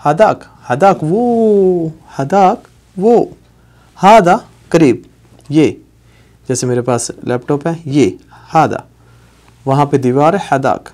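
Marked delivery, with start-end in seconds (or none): 0.0-5.7 stretched-out teaching take; slow demo. Shown as a demonstration. Teacher modelling the Arabic phrase slowly for learners. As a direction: lay out each word slowly. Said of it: "یہاں پہ کہیں گے".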